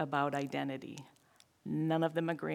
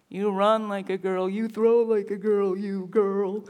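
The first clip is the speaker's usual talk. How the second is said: put-on louder jeering kid taunt; mocking taunt; 3 semitones higher, 8 dB louder than usual. Boys taunt a girl at play, loud and mocking. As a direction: jeer it like a schoolyard bully.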